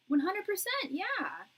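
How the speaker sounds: high, loud burst of total agreement; excited agreement. Real, not acted. Reader cheering on the viewer, excited and loud. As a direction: shoot up and cheer it out.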